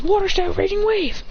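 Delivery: high-pitched anime technique call; mock battle incantation; calling an attack. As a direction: declare the move dramatically. Character calls out attack move.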